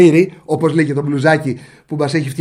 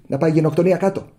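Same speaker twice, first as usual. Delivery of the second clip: ordinary delivery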